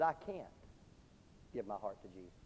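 put-on dying boy's refusal. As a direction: let it fade like a last breath. Dying boy refuses salvation.

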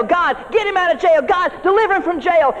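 high, pleading cry to God; pleading church prayer. Retold or imitated. The church begging God for Peter's release, high pleading.

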